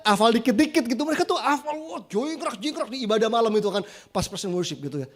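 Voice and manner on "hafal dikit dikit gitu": preacher recalling his own impressed remark, mock amazement; impressed exclamation. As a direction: play up being wowed by their singing. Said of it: "Saya bilang".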